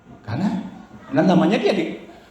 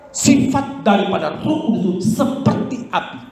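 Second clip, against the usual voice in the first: usual speaking voice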